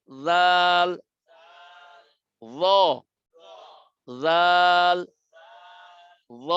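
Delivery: জাল held long and loud against short ল; sustained letter drill. Shown as a demonstration. Teacher drilling ল against a long, loud জাল. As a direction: drag out জাল each time. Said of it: "ল আর জালের পার্থক্য".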